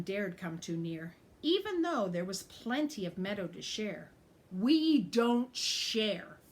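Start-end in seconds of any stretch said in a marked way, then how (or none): 4.5-6.4 slow, loud growl of a bully goat. Acted. Bully goat growls at the other animals, slow loud growl. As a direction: drag the words out in a loud growl. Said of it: "Tough growled".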